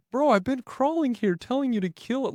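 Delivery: exasperated. Retold or imitated. Scolding his hunting buddy for not killing the deer, exasperated. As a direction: let the frustration burst out, high.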